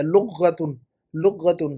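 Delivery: لغتن as "lugh-ghatun", doubled gh; mispronunciation. Shown as a demonstration. Teacher quotes student's earlier mistake, mispronunciation. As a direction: close لغتن's first syllable on a doubled gh. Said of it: "لغتن کو غلط استعمال کیا".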